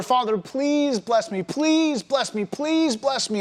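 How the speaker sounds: high, drawn-out mock pleading without sobs; tearful pleading. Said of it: "he begins to weep and cry".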